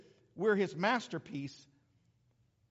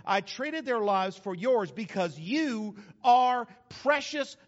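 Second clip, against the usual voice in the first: forceful scripture reading; 4 semitones higher, 7 dB louder than usual. Acted. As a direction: proclaim the verses like a promise. Reads Isaiah with force.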